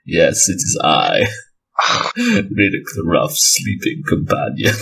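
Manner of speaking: slow, low attempt at a Scandinavian accent; bad Scandi accent. Game master trying the sleeping companion, bad Scandinavian accent. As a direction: ham up a poor Scandi accent, slowly, laugh breaking in. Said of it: "oh, I can't do a Scandi accent".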